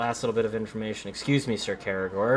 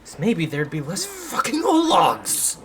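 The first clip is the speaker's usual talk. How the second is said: frustrated; 6 semitones higher than usual. Real, not acted. Frustrated with enemies.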